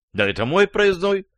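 high-pitched indignation; возмущённо. A narrator's reading. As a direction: protest indignantly, voice pitched well up. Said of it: "возмутился Иван Иванович". (возмущённым высоким голосом)

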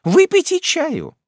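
high, slow stern order; commanding aunt. A narrator's reading. Miss Betsey ordering the widow to drink tea, stern. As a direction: order it, no arguing.

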